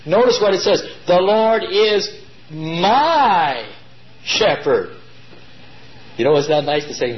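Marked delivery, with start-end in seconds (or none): 1.1-5.0 slow build, "my" set apart and held; stress on my. Shown as a demonstration. Preacher lifting out "my" for his listeners. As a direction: pause and lean on "my". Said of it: "the next one is even better".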